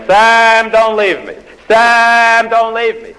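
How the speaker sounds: high, loud wailing plea to a dying husband; desperate yell. Retold or imitated. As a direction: scream his name, begging him to stay. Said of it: "and his wife stood there and yelled".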